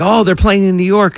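excited fan. Pretend. Imagined French fan, excited.

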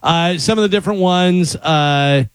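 normal talking voice

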